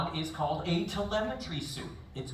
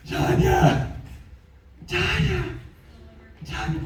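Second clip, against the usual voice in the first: calling out; 5 dB louder, slower than usual. Acted. Calling for the victim.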